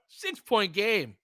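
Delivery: normal talking voice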